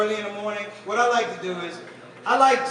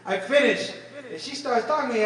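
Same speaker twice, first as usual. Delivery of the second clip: usual speaking voice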